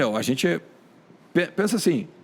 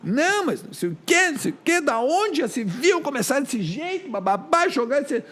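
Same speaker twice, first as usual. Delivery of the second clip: much higher mock-outraged fan voice; angry fans; 10 semitones higher than usual. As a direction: squeal it like an outraged fan mocking the coach's choice. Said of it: "a minha audiência acha que foi uma merda".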